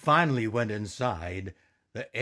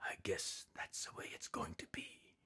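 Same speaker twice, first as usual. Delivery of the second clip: resigned whisper, far softer; 4 semitones lower, 17 dB quieter than usual. Acted. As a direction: let it out as a hushed sigh of acceptance. Resigned aside after whispers.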